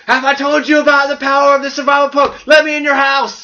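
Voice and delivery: high, loud mock-evangelist yell; hammed-up missionary shouting at the door; door-knocking zealot. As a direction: holler like a pushy doorstep preacher demanding entry. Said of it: "like, a Jehovah's Witness, knock on your door".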